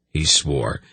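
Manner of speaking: ordinary delivery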